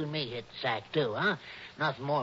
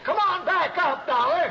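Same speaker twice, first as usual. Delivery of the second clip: higher still, urgent call downward; 11 semitones higher, 3 dB louder than usual. Acted. Shorty calling down to Dollar, more urgent call. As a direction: shout him back up, pleading now.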